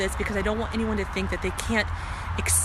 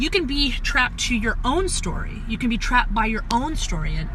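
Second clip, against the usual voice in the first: ordinary talk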